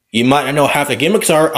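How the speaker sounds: normal talking voice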